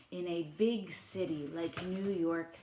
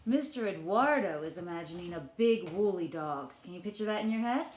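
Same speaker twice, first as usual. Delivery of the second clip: ordinary delivery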